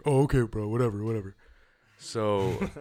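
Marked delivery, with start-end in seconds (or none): 0.1-1.3 imitating a careless drunk bro; mocking dismissive bro. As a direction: shrug him off like a cocky bro. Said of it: "And he's like".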